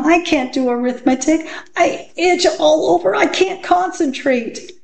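put-on high-pitched whining child; itchy pupil's high-voiced protest; distressed schoolgirl Dana. A narrator's reading. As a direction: complain to the teacher in a high little voice.

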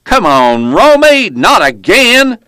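loud, high-pitched holler from a truck; exasperated yell. A narrator's reading. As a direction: holler it out, dragging the last words. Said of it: "The driver leaned out and yelled".

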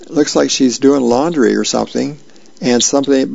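ordinary talk

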